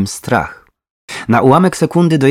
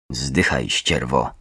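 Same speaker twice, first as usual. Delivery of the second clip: low, soft, slurred curse; hushed, deep, venomous mumble; mumbled; 9 semitones lower, 7 dB quieter, slower than usual. A narrator's reading. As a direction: mumble it under the breath, spent. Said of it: "wybełkotała".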